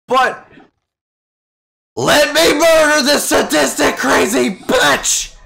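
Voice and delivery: high, loud desperate yelling. Acted. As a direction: shout it out in pain and rage. Character yelling while electrocuted.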